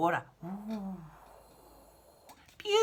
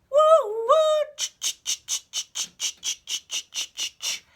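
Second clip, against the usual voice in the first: high loud whistle woo, then unvoiced chugging ch; steam train imitation; 7 dB louder than usual. Shown as a demonstration. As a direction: toot like a steam train, then chug along. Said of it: "Can you make that noise?".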